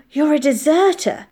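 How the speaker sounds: high, loud, gasped accusation; shocked. A narrator's reading. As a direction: blurt out the shocking discovery. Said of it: "Frank gasped".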